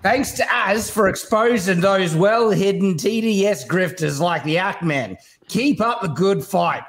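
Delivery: slow, deliberate read in an Australian accent. Shown as a demonstration. Guest reading a donor's message on request, Australian accent. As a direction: read it out slowly, thick with Australian. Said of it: "That's a pretty good Australian accent".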